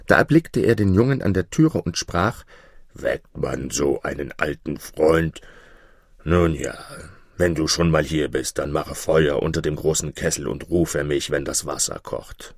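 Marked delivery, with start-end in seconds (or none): 2.9-12.5 low giant voice, softening at the end; woken giant. A narrator's reading. Giant just woken gives the boy orders, low grumble.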